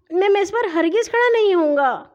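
put-on high, whiny little-boy protest; stubborn high-pitched objection; defiant refusal. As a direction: refuse indignantly as a child. Hashim defiantly refuses the punishment.

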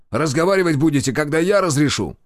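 barked order. A narrator's reading. Major forbids them to talk, barking. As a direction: bark it like an officer in charge. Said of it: "рявкнул Комаров".

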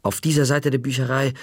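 usual speaking voice

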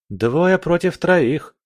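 медленно, высоким голосом, с вызовом; протяни, как довод в споре; замечание с вызовом. A narrator's reading. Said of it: "заметил Малик". (врастяжку, с вызовом)

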